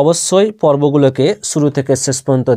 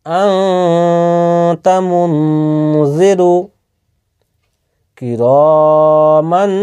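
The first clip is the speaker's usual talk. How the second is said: slow, melodic tajweed chant with long nasal ghunna; Quran recitation; 3 semitones higher, much slower than usual. Shown as a demonstration. Teacher recites tajweed examples for his students, slow melodic chant. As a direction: chant each word slowly, drawing out the nasal hum.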